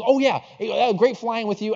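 high, loud, friendly acted greeting; cheery retold greeting. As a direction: imitate an upbeat seatmate calling out goodbye.

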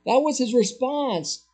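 normal talking voice

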